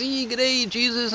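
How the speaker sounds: high put-on customer voice. Second customer greeting the clerk, high and dopey. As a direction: greet him like the dopey regular.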